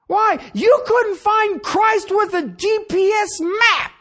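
high, loud preacher's build to a shout; preaching at full force. Acted. Preaching climax on the law.

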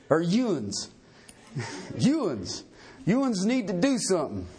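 low Southern drawl, lightly put on; a folksy mock Southern drawl. Preacher joking that God says y'all, light Southern drawl. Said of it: "do it in Southern drawl".

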